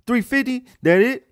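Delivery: very high, loud disbelieving question; loud obnoxious customer. Retold or imitated. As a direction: blare it back as a brash shopper. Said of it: "be that loud and obnoxious".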